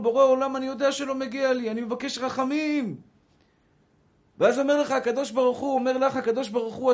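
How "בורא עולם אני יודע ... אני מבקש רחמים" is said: pleading cry. Retold or imitated. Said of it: "ואז אתה צועק לו".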